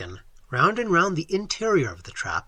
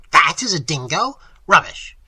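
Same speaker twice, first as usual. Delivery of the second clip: louder, mocking disbelief; scornful; 8 dB louder than usual. A narrator's reading. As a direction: scoff at the claim. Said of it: "cried Professor Small scornfully".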